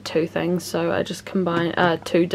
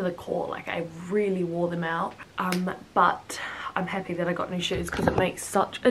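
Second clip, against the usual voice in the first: whispering; 9 dB quieter than usual. Real, not acted. Whispering aside about shoes.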